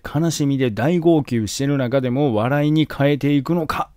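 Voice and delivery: ordinary talk, nothing marked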